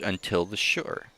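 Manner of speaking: ordinary delivery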